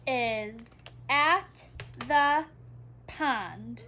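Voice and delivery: each word read out separately and loud; teacher pointing word by word; slow word-by-word reading. Shown as a demonstration. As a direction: model reading one word at a time. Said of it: "Let's read it together".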